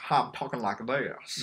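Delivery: imitated smooth Southern accent; mock Southern gentleman accent; Daniel Craig. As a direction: do a movie detective's Southern voice. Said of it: "Daniel Craig's Southern accent".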